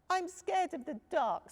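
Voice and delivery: high, small frightened cub voice; Little Bear. A narrator's reading. Little cub telling dad he's scared. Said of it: "said Little Bear".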